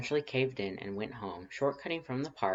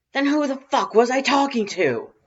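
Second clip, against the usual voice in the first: much higher, louder bewildered yell; 14 dB louder than usual. A narrator's reading. Confused caller yelling about the mix-up, loud angry shout.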